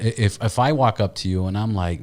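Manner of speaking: ordinary talk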